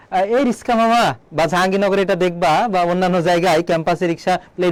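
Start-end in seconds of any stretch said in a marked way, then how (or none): 0.3-1.0 higher-pitched mock-stylish rickshaw hail; stylish girlfriend call. Teacher mimicking a girl calling a rickshaw, affected style. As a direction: put on a girl showing off, saying "riska". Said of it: "মেয়েটা একটু style দিয়ে বলে".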